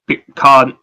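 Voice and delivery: ordinary delivery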